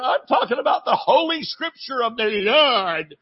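indignant plea to be taken seriously; protesting son. Retold or imitated. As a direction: son objecting to father's teasing. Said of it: "And I'm saying".

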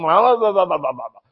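trailing nonsense babble; dismissive run of blahs; mocking babble. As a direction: mimic a complainer droning on. Mocking people's endless complaints.

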